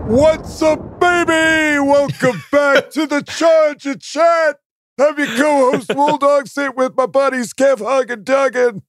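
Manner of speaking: very high, screamed podcast welcome; hyped show intro. Acted. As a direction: yell the welcome like a hype man, drawing words out. Shouted show opening.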